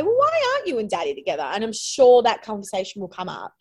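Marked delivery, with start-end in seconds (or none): 0.0-1.4 put-on child asking. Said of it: "kind of say".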